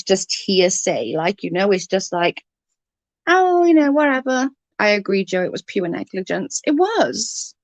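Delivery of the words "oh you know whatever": mock blasé. Mocking Leslie's blasé tone.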